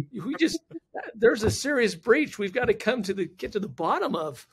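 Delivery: high, loud retold alarm easing off; urgent warning. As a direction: imitate her insisting on action. Retelling her urgent warning of breach.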